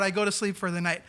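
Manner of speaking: ordinary talk, nothing marked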